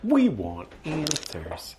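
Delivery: slow, high, mock-dramatic; dramatic slow declaration. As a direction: intone it like a spooky trailer.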